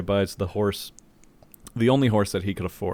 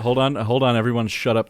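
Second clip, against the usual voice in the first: louder, raised-pitch interruption; 3 semitones higher, 6 dB louder than usual. Real, not acted. Joke-teller hushing friends before a special joke, loud and insistent. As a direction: talk over the group to hush them.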